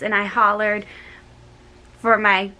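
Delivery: usual speaking voice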